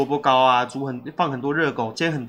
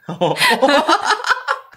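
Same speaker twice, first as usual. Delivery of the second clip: high rising laugh bursting out; burst of laughter; 11 semitones higher, 4 dB louder than usual. Real, not acted. Joking with a friend about eating spilled breakfast, high burst of laughter. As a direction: let a big laugh break free.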